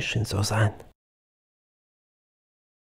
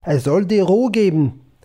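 raised, higher stern rebuke; stern father; 6 semitones higher, 6 dB louder than usual. A narrator's reading. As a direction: lay down the law as a strict father. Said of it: "sagte der Vater strenge".

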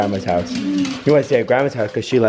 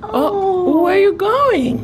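pet voice; 4 dB louder, much slower than usual. Real, not acted. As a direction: coo up high like talking to a kitten. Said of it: "don't judge me on the voice that I use".

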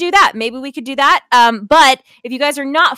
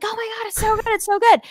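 high, lightly imitated fan rave; mock gushing; 10 semitones higher, faster than usual. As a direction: mimic gushing friends in a raised pitch. Mimics raving fans.

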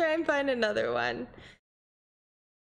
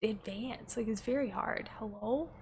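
quiet; 3 semitones lower, 7 dB quieter, faster than usual. Real, not acted. Voice dropping away.

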